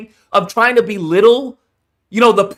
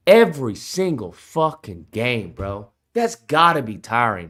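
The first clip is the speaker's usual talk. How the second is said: ordinary delivery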